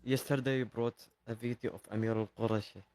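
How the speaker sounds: quiet. Real, not acted. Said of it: "Can you speak louder".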